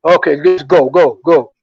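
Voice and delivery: low, loud officer waving someone on; imitating a cop, low and insistent; flustered cop giving up. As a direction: act out a cop's hurried dismissal. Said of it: "And he said".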